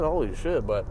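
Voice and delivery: ordinary delivery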